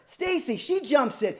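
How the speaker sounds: normal talking voice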